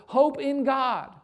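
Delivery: rally your own soul with fervour; rising preacher's call to rejoice; preaching to the soul. Pretend. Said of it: "you say to your soul".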